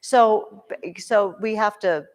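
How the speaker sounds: ordinary delivery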